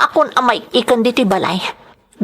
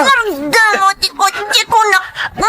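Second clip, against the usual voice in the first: much higher shouted demands; screaming; 9 semitones higher than usual. Acted. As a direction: keep screaming at him to stop. Keeps screaming at driver.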